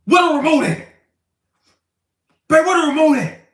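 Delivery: high, loud yell for the remote; demanding husband shouting. Retold or imitated. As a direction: bark at your wife from the couch. Husband yelling at wife.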